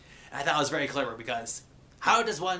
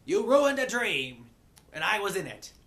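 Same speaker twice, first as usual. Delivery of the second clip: higher-pitched, indignant retort; snapping accusation; 6 semitones higher, 4 dB louder than usual. Acted. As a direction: snap back, drawing out the blame. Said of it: "this ostrich woman snaps at him".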